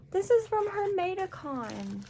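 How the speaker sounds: very high, overwhelmed squeal of words; thrilled disbelief. Real, not acted. Fan finding an item from the missed convention, thrilled disbelief. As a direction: squeal it out, real amazement.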